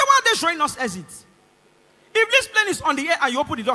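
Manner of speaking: loud, high-pitched objection; alarmed protest. Retold or imitated. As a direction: protest as a nervous passenger acted out. Frightened first flyer protests loudly.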